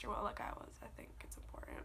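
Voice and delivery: whispering through tears; tearful whisper. Real, not acted. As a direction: voice choked to a whisper. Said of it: "Still not crying, I'm just stuffy".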